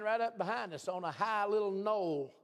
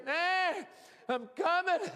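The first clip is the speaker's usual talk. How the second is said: higher, louder bleating reply, laughing at the end; sheep answering; 9 semitones higher, 3 dB louder, slower than usual. Retold or imitated. Sheep climbing out of the valley calling back, laughing bleat. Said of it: "they'd answer".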